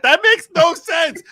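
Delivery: high, loud mock whine; hammed-up high complaint at mom; exasperated kid voice. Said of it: "and I'm like".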